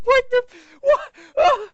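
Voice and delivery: very high, loud startled cry; imitating his own panic; mock shock. Retelling guns fired at a wedding, high startled cry. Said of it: "I was like".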